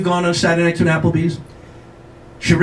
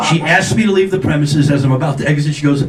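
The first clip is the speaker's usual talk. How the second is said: ordinary delivery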